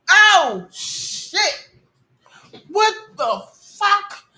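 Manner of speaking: high yelp, then a breathy gasp of fright; real jump-scare reaction; scared shriek. Real, not acted.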